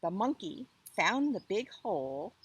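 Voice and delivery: ordinary delivery, nothing marked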